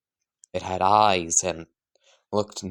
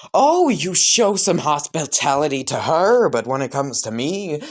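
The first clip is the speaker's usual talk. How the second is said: louder, higher whiny protest; indignant complaint; 6 semitones higher, 5 dB louder than usual. Acted. Son complains to his mother, loud and indignant. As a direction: sulk loudly about being left out. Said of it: "as Bakugo would just go".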